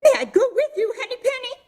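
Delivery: very high, eager squeaky bird; an eager little bird begging to come along. Acted. Another bird voiced in fable.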